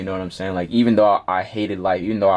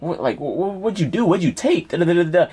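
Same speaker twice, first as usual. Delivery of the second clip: much higher urgent nurse questions; grilling nurse; 8 semitones higher than usual. Retold or imitated. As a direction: press him hard like a worried nurse. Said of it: "the nurse getting on me".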